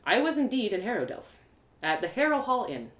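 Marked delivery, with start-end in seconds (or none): none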